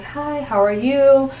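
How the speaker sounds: a high, drawn-out hello. Shown as a demonstration. Coach demoing a client's high, slow greeting. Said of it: "if they're speaking like this".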